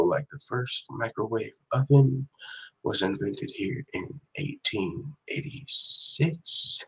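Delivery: hushed, slow golf-commentator hush; golf commentator whisper. Retold or imitated. Streamer imitating Ariel's golf commentator whisper for chat. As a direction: mimic the soft broadcast hush of a golf announcer. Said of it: "whispering like a golf commentator".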